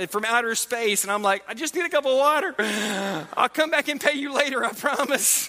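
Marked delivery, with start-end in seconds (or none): 1.5-5.5 higher, pleading, half-panicked dad; begging the clerk for water in a rush of panic; frantic plea. Retold or imitated. A dad with vomit down his back pleading with the clerk, high and frantic.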